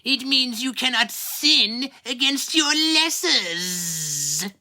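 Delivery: high, put-on old grandmother voice, coaxing; a doting, tipsy grandmother lecturing her grandchild; grandmother's voice. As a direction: grandson recalls grandmother telling him he is beyond sin, high and coaxing. Grandmother voiced in man's story.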